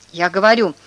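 ordinary delivery

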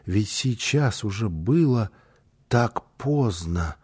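slow, subdued worry; understated, softly troubled; anxious. A narrator's reading. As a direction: let the worry drag the words out. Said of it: "с тревогой спросил малыш".

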